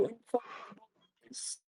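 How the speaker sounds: choppy connection, voice cutting out; bad mic, words dropping out; breaking up. Real, not acted. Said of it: "You're breaking up and super quiet".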